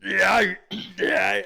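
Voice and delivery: hammed-up Arnold cry, mocking; mock Arnold impression. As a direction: blurt a crude Arnold impression. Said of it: "or do you just go".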